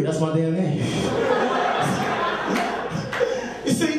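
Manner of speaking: ordinary delivery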